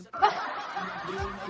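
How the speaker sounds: a short real laugh at his own slip. Real, not acted. Laughing at misreading a name. (tunay na tawa)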